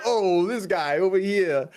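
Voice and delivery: high slow mocking voice; mocking Midge. Roaster imitating Midge sneering at the streamer, high and slow. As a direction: drag it out, high and snide. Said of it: "He's like".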